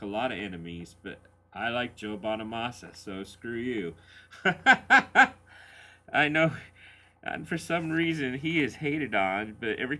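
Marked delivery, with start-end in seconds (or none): 4.0-6.1 loud laugh. Real, not acted. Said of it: "laughs at own jab".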